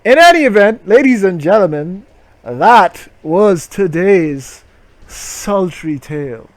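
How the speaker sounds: hammed-up presenter voice; show host outro. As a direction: sign off like a cheesy host.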